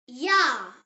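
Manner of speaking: teacher model of Jahr with a Y sound; model pronunciation. Shown as a demonstration. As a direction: say Jahr like yah. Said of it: "Say it".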